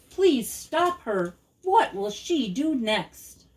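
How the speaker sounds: milk the last refrain, pleading; slow, lifted closing plea; drawn-out final refrain. A narrator's reading.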